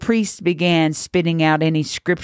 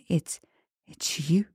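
faint, much softer stammered realization; whisper; 2 semitones higher, 8 dB quieter than usual. A narrator's reading. Priest realizing who the witch is, frightened whisper. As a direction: barely breathe the words out in dread. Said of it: "His words came out as a whisper".

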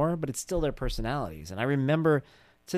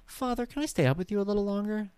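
put-on high, prissy little-girl voice; prim polite child; 8 semitones higher than usual. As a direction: play the impossibly polite daughter. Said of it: "Wouldn't that be great if she said that".

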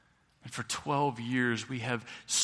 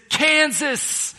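much higher, loud mock announcement; announcing caller; 12 dB louder than usual. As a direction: blurt it out like big news. Placement office announces the job.

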